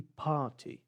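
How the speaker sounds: PARTY as "pah-tee", no R; British, silent R. Shown as a demonstration. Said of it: "A mesma coisa acontece aqui". (PARTY sem R, à britânica)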